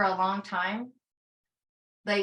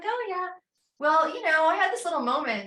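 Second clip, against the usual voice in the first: sheepish admission; 9 semitones higher, 5 dB louder than usual. Retold or imitated. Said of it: "I'm like".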